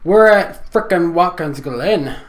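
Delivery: put-on slow, loud, odd accent; silly put-on voice. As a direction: drawl it out in a goofy accent. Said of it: "That voice".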